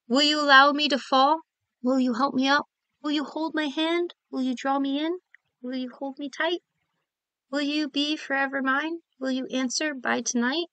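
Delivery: soft, tender reading of a love poem. Acted. Poet reading her nervous proposal poem, soft and tender. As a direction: read each question gently, hopefully.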